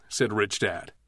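plain reading voice

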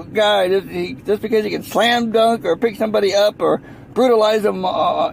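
normal talking voice

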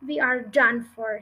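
ordinary delivery

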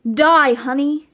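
loud vicious snarl. A narrator's reading. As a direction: scream it in attack. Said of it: "she snarled".

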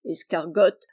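ordinary delivery

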